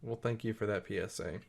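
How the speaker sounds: ordinary delivery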